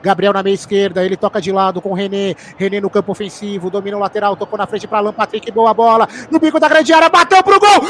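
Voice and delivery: fast, building radio commentary; live play-by-play. Real, not acted. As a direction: rush the play as it unfolds.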